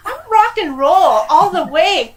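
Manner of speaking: high, loud, laughing wail of protest; a California girl's mock despair at Nashville; mock outraged protest. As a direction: cry out in hammed-up disbelief at being sent away. Protesting being sent to Tennessee.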